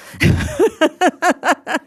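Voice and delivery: brief amused laugh; short laugh. Real, not acted. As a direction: laugh it off lightly. Laughs after teasing remark.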